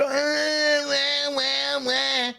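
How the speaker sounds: high, droning fake wail; wah-wah in sarcastic sobs; mock crying. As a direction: fake baby-like bawling. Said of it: "have a good old fucking cry".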